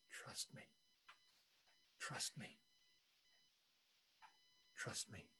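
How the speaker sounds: breathy whisper, barely there; whispered plea. Acted. Preacher voicing God to the congregation in a whisper. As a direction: let God's plea fall to a whisper.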